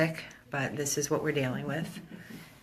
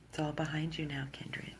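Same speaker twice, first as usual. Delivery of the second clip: soft whisper, much softer; comforting whisper; 10 dB quieter than usual. Real, not acted. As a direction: whisper reassurance to the dog. Comforting the dog softly.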